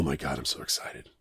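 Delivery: hushed and thrilled, almost to himself; hushed excited whisper. Real, not acted. Host whispers his thrill at the next episode, hushed.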